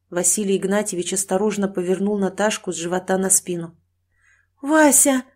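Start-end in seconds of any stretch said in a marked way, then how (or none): 4.6-5.2 high, shocked call to husband; startled, drawn-out cry; gasping exclamation. Acted. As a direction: gasp out the name in alarm. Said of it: "ахнула Мария Петровна".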